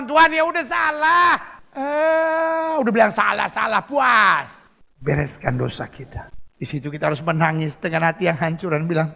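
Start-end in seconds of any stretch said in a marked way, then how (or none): 0.0-4.5 high-pitched grudging imitation; mock confession. Sinner admitting fault to God, mock confession. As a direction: toss out a careless confession in a raised voice.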